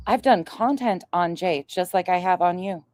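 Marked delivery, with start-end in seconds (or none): none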